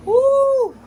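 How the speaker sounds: high, loud whoop at the burn; real spicy whoop; heat whoop. Real, not acted. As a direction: let out a burning whoop. Spicy reaction.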